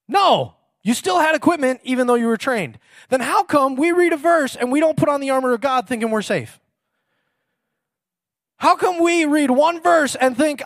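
high, loud pulpit preaching; preaching at full force. Acted. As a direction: drive the point home to the congregation.

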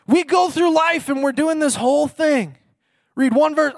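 high, loud pulpit preaching; preaching at full force. Acted. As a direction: drive the point home to the congregation.

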